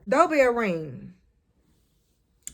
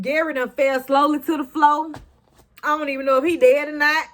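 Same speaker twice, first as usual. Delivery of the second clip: normal talking voice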